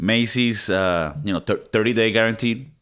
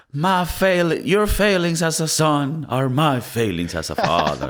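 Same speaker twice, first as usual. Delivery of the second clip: raised, theatrical film-emperor quote; Gladiator emperor; 7 semitones higher, slower than usual. Retold or imitated. Quoting the Gladiator scene to co-hosts, higher dramatic voice. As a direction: declaim it as a mock tragic father. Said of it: "He's like".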